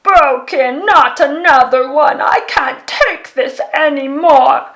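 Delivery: big loud wailing monster voice; a huge Yeti howling over his broken violin; dismal Yeti roar. A narrator's reading. Yeti despairing loudly over broken wood, loud wail. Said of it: "heard a dismal roar".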